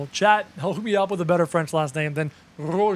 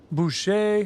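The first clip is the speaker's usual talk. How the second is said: normal talking voice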